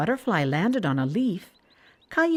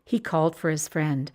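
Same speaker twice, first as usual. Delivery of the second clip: ordinary delivery, nothing marked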